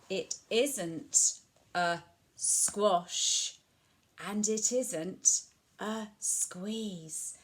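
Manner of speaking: slow, drawn-out emphatic refrain. Acted. Repeating the story refrain slowly for children. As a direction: stretch each word for the children.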